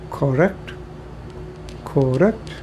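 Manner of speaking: a light Indian English lilt on Correct, rising; mock Indian English Correct? with a rising question; Indian English accent. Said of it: "That's what they say in India".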